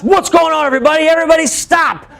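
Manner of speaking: high, loud neighbor yelling for order; neighbor calling out. Retold or imitated. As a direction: shout everyone to a halt. Neighbor calls out to stop.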